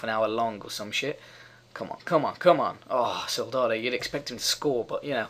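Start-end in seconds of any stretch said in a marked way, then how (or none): none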